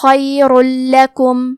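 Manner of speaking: خیر لکم with the tanween merged into a doubled lam, no nasal hum; merged noon. Shown as a demonstration. Teacher shows students the merging, very slowly. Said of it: "تنوین کو لام میں ملا کے بغیر غنہ".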